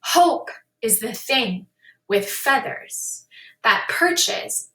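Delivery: slow reading that leans on the bolded syllables; stressed reading. Shown as a demonstration. Teacher demonstrates the stressed syllables of a poem, slow and deliberate. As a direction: read Hope is the thing with feathers slowly, hitting each stressed syllable. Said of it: "bold letters to show where they want to hear the stress".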